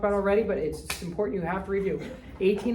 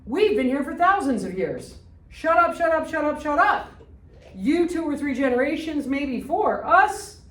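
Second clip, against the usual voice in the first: angry shout, higher and louder throughout; Algerians shouting; 5 semitones higher, 5 dB louder than usual. Retold or imitated. Teacher voicing Algerians shouting back at the colonizers, furious yell. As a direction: yell it like a colonized people fed up. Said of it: "What do the people in Algeria say?".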